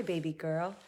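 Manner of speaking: normal talking voice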